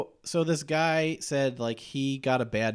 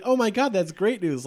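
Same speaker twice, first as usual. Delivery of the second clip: higher-pitched imitation of delight; playfully retold excited relief; mock excitement; 6 semitones higher, 4 dB louder than usual. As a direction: act out a client thrilled by good news. Acting out client hearing good news.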